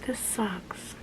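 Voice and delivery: whispered under the breath; soft unvoiced grumble. Real, not acted. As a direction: mutter it to yourself. Sad about removing piercings.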